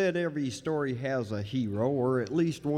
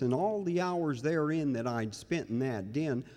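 usual speaking voice

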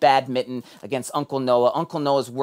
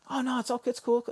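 lightly voiced kid; 11 semitones higher, 5 dB quieter, faster than usual. Kid voice brushing off apology.

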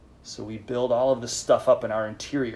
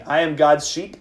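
louder and higher, for the group to echo; leading a repeat-after-me; 5 semitones higher, 10 dB louder than usual. Shown as a demonstration. Priest leading the group in a repeated phrase, called out. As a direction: call it out to the congregation. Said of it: "Say that with me".

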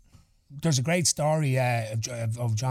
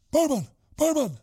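high, louder call across the bar; 14 semitones higher, 4 dB louder than usual. Retold or imitated. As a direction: shout for the barman in a high voice. Mullane calling out to the barman.

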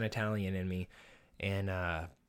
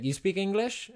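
higher-pitched imitation Russian accent; put-on Russian accent; 9 semitones higher than usual. Russian stranger asking the student once more, high mock Russian accent. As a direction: repeat the question in a shaky-grasp Russian accent, pitched up. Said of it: "what accent I'm doing right now".

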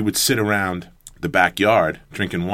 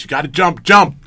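raised, higher-pitched retold shout; urgent warning; 8 semitones higher, 9 dB louder than usual. Teammate warns coach of an oncoming car, urgent retold shout. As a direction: imitate yelling a warning, punching the final word.

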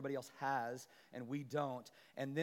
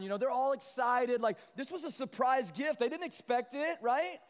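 ordinary talk, nothing marked

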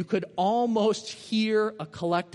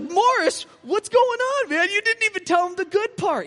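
high, loud incredulous exclamation; exasperated outcry; 14 semitones higher, 7 dB louder than usual. Retold or imitated. Telling Morris he missed the good part, loud and incredulous. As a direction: call out in excited disbelief. Excited disbelief at friend.

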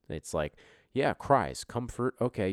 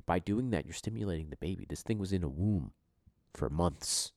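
usual speaking voice

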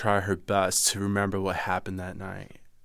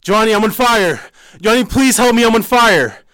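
high, loud, panicked scream of someone burning; desperate high screaming for help, fire; 14 semitones higher, 13 dB louder than usual. Retold or imitated. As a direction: shriek for Johnny in terror. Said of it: "And then I yelled".

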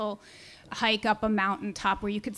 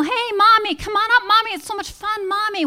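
much higher, louder call from far above; 9 semitones higher, 5 dB louder than usual. Retold or imitated. Son and dad calling mom up the cliff, loud call. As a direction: holler down the mountain to mom. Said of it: "they yell".

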